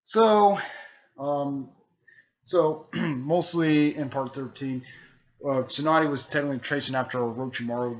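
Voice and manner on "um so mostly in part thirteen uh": ordinary delivery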